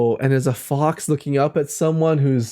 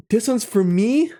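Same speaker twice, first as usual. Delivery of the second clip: surprised; 7 semitones higher than usual. Real, not acted. Surprised the card is theirs.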